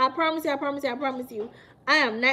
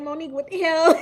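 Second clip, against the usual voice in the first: laughing exclamation; 3 semitones higher, 5 dB louder, faster than usual. Pretend. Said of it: "You're gonna be like".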